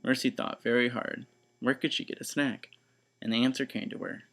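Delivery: usual speaking voice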